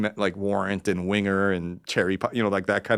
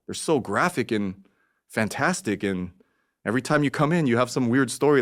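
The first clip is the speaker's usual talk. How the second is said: ordinary delivery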